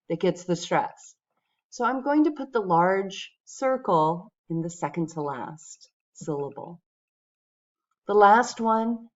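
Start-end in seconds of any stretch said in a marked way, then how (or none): none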